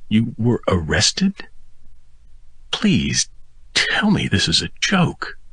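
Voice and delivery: put-on a frightened girlfriend begging for denial; worried girlfriend. A narrator's reading. Girlfriend's worried texts voiced.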